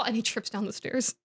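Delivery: ordinary talk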